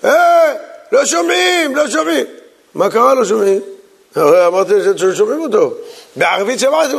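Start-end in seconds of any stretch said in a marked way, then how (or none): 0.0-2.3 mock shouts from the back. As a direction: call out like the congregation can't hear. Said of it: "פתאום אתה שומע צעקות".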